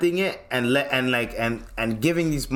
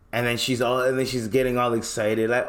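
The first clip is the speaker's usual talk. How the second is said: ordinary talk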